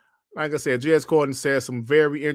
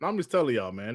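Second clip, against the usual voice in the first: ordinary talk, nothing marked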